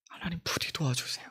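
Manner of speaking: breathless whispered petition to God; a pastor voicing a whispered prayer for help. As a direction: whisper it as a desperate plea.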